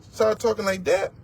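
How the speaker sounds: ordinary delivery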